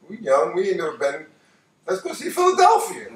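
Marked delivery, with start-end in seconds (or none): 1.9-3.1 much higher, eager pitch on the idea; excited suggestion. Retold or imitated. Young guy on the highway pitching a Philly detour, excited. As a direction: pitch it up like a sudden road-trip plan.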